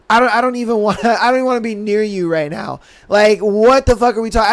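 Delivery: ordinary delivery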